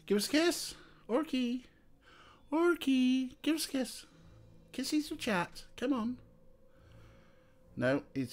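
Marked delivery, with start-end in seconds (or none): 0.0-6.2 soft, very high falsetto; high sing-song pet voice. Real, not acted. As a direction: plead with the cat in a tiny high voice. Begging the cat for kisses.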